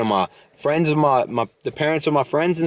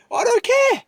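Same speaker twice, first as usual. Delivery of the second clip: defiant yell. Real, not acted. Podcaster yelling at people staring outside, very high and defiant. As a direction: shout it at the passers-by. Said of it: "they're looking at me like I'm yelling".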